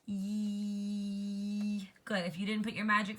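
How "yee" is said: Yee held out long, a tickle at the back of the throat; stretched Y. Shown as a demonstration. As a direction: stretch the Y sound long and silly. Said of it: "Try it with me".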